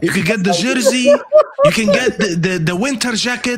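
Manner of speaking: high-pitched mimicry of Mo's accent. Host mimicking Mo to sell jerseys, Mo accent. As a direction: keep up the put-on Mo accent. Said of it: "do it in the Mo accent".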